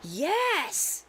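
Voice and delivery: high, drawn-out excited yes; emphatic yes. Retold or imitated. Little girl answering that she sees the light, excitedly. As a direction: imitate an eager child's yes. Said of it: "tips the table real deep, like, pushes against me".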